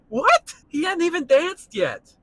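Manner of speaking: very high, loud outraged squawk; heated disbelief. Real, not acted. Reacting to Fred Astaire's early kiss, heated disbelief. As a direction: burst out high and loud, appalled. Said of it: "That really did get me heated up".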